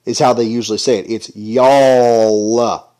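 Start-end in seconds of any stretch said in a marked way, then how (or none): 1.1-2.9 drawn-out Southern "y'all", jaw dropped; proper Southern y'all. Shown as a demonstration. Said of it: "You gotta drop that A down there".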